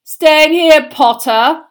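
loud, high taunt; shouted schoolboy sneer; jeering shout. A narrator's reading. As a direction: call out mockingly across the hall. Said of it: "shouted Malfoy".

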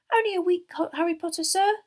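high, loud, cheerful elf; delighted little servant, very high-pitched; happy squeak. A narrator's reading. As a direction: pipe up happily in an elf voice. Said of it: "said Dobby happily".

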